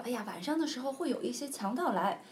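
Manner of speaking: a bird warns of robbers; lightly voiced fretful bird; warning bird.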